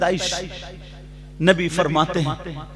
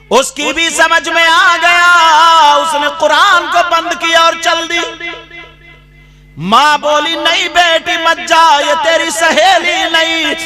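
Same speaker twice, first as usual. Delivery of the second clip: loud, drawn-out sermon storytelling; fiery pulpit narration; preaching at full force; 12 semitones higher, 14 dB louder, slower than usual. Acted. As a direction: hammer the tale home to the hall. Preacher dramatizes the cautionary tale.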